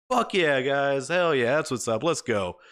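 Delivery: high, loud whoop of a win; celebrating a win with the squad; retold victory cheer. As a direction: cheer like you just won the match.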